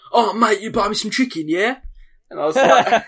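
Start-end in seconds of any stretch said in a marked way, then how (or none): 0.1-1.8 high, loud London street voice; imitated street beggar. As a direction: play the pushy man cadging chicken. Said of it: "he's like".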